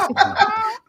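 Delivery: high burst of laughter. Real, not acted. Laughs at legs voice.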